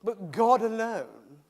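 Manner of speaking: slow, weighted rebuke; a hint of a pompous teacher; indignant question. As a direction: draw it out like a scandalised scholar. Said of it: "this chap stands up and he says".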